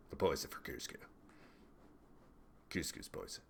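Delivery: low, soft cartoon henchman impression; Kronk voice. Streamer trying out Kronk for candidate quotes, deep Kronk impression. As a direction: drop it way down into a Kronk voice. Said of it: "in the Kronk voice".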